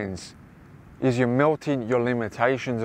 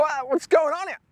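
very high, squeaky shocked voice; mock shock. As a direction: squeak it out in fake alarm at the bill. Shocked at price over ten.

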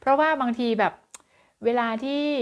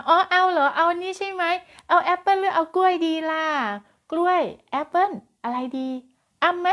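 put-on high, louder, eager questions to a toddler; delighted coaxing mother; 8 semitones higher, 3 dB louder than usual. Shown as a demonstration. Teacher demonstrating the better way to coax a child, high and eager. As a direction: coax brightly like a thrilled mom. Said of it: "ก็กลายเป็นว่า".

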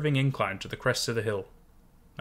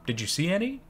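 anxious; 7 semitones higher, faster than usual. A narrator's reading. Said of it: "I asked anxiously".

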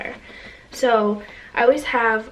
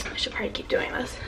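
quiet aside; 2 semitones higher, 9 dB quieter, faster than usual. Real, not acted. Aside to camera.

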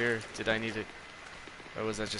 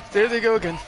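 There they go again, said louder and higher; louder second time, alarmed; 7 semitones higher, 6 dB louder, faster than usual. Real, not acted.

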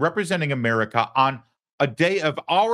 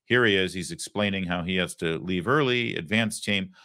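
normal talking voice

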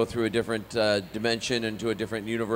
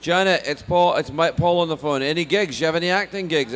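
loud, raised Scottish voice calling out; Scottish father; 5 semitones higher, 6 dB louder than usual. Retold or imitated. Dad on phone asking about gigs, eager Scottish. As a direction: call to your wife in broad Scottish. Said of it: "they got more of the lingo down".

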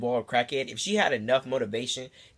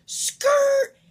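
high, loud imitated car skid; cartoon skid noise, high and loud; tire screech; 8 dB louder than usual. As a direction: screech it like tires peeling off. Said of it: "a car just goes".

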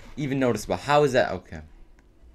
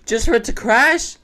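whiny complaint; 9 semitones higher, 4 dB louder than usual. Real, not acted. Complaining after crash.

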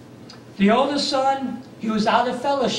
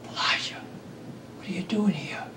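soft unvoiced whisper, much quieter; gentle whisper; 3 semitones lower, 9 dB quieter than usual. God gently asking Elijah in the cave, whispered. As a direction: whisper it tenderly, like God calling. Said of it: "there came a gentle whisper".

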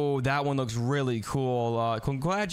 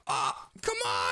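strained cry; slower than usual. Real, not acted. Struggling against the sensei.

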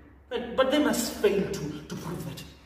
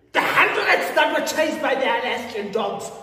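higher, louder, insistent; impassioned protest; 6 semitones higher, 4 dB louder than usual. Acted. Telling Manuela that was me among the protesters, indignant. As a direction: build a forceful, indignant testimony. Angrily claiming the protesters' place.

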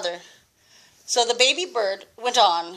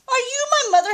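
high, quick little bird question; baby bird voice; much faster than usual. Acted. Grandma reading to James, the baby bird asking the kitten, high and quick. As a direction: ask it fast and high like a tiny chick. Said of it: "he said to the chicken".

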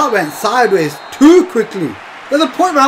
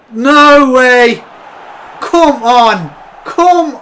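loud shout of disbelief; angry outcry; disbelieving shout; 3 semitones higher, 5 dB louder, much slower than usual. Real, not acted. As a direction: shout it as the goal goes in. Conceding late goal.

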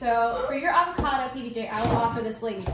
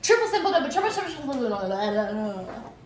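fast tongue twister; 4 dB louder than usual. Shown as a demonstration. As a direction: rattle it off quickly and loudly. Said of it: "It has to be like".

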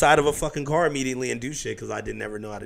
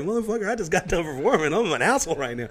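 high exasperated mock reply; annoyed retort; 5 semitones higher than usual. Rapper voicing his tired reply to a fan. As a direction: snap back at the fan, half joking. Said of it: "It's like".

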